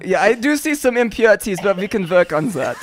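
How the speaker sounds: mock-German inspector; German accent. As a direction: appraise in a hammed-up German accent. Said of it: "You still gotta do the German".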